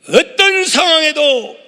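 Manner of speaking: loud, high, drawn-out proclamation; emphatic preaching. Real, not acted. Preacher leading the congregation to repeat after him, loud declaration. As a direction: proclaim it slowly at full voice.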